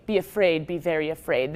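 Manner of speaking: low, ominous warning; spooky movie voice. Shown as a demonstration. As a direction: intone it like a horror trailer. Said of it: "I'm gonna show you".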